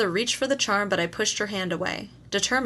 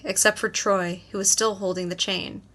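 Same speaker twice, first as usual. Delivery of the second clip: usual speaking voice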